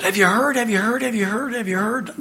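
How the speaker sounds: lightly voiced schoolkids spreading news; excited kids. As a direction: fire it off like a bunch of kids crowding round. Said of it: "a bunch of kids came up and said".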